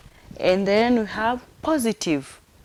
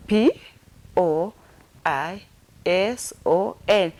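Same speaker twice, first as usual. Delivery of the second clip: slow, spaced-out letters; spelled out; slower than usual. Shown as a demonstration. Teacher finger-spelling poison for learners, spelled out. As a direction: spell it one letter at a time. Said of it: "let me finger spell it".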